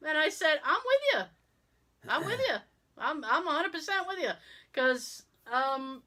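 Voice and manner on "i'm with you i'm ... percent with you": emphatic agreement. Retold or imitated. Agreeing with the cashier.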